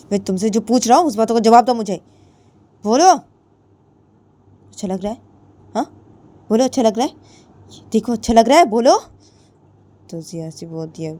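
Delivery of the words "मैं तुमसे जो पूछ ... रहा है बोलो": loud, high insistent questioning; angry demand. A narrator's reading. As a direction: press her hard for an answer. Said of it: "और बोलती है". (ऊँची, तेज़ आवाज़ में जवाब माँगती)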